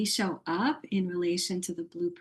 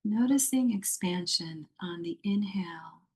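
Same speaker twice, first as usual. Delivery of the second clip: guided meditation. Acted. Yoga teacher guiding a muted online group into yoga nidra, meditative. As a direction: lead them gently into rest, phrase by phrase.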